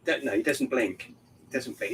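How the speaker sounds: ordinary talk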